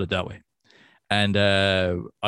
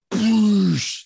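loud, higher burst of spraying noise; imitated spit take; 7 semitones higher, 5 dB louder than usual. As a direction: explode it out like a mouthful of drink. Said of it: "oh, a spit take".

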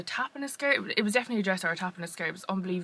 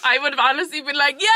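high, giggly and louder; laughing; 10 semitones higher, 5 dB louder than usual. Real, not acted. Laughing as she pictures answering the crew. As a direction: laugh through it. Laughing retelling her reaction.

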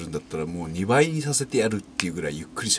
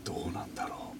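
whispering; 3 semitones higher, 10 dB quieter than usual. Real, not acted.

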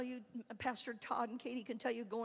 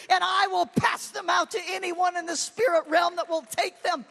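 near-shouting, high and much louder, pleading to her pastor; impassioned tearful plea; 7 semitones higher, 12 dB louder than usual. Retold or imitated. Pleading with her pastor to accept the car, tearful near-shout. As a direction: pour it out at full force, close to tears. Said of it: "Finally, I'm weeping".

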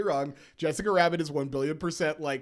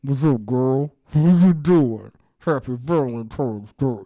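louder, talking through sealed lips; mouth shut; 6 semitones lower, 7 dB louder than usual. Pretend. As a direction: speak with your mouth sewn shut. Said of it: "because I have a rose in my mouth, uh, my recording's gonna sound something like".

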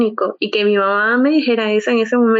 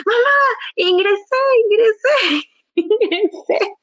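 very high, tearful cries of joy; excited yelling; 11 semitones higher, slower than usual. Retold or imitated. Running to mom with the news, high tearful joy. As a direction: shriek the news through happy tears. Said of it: "Y yo gritando".

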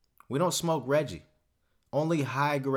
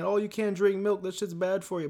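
mocking naysayers; 8 semitones higher than usual. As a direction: mimic people nagging about milk. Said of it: "People saying".

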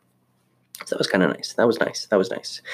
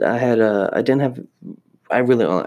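normal talking voice

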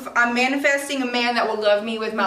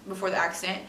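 ordinary talk, nothing marked

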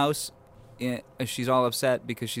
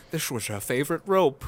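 lightly put-on slushy Scottish movie-star voice; a hint of mock Scottish gravity; Sean Connery. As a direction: do a joking impression of the actor. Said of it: "there's a scene of Connery".

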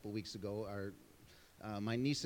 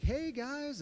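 cheery hello; 12 semitones higher, 4 dB louder than usual. Retold or imitated. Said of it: "I'm like".

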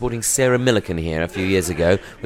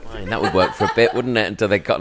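normal talking voice

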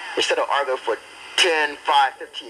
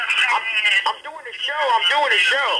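excited calling out; 9 semitones higher than usual. Real, not acted. Kid calling out over chat line.

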